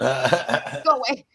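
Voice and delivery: slow, stretched "Go away" in an odd put-on voice; drawn-out go away. Real, not acted. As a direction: drag the words out teasingly. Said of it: "I love you. Go away".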